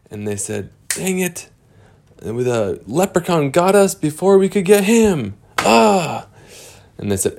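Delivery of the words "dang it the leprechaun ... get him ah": high voice, mild frustration; high grumbling character; high frustrated character. Acted. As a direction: grumble about being caught.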